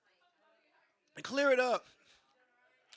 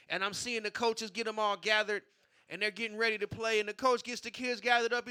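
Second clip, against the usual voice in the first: ordinary talk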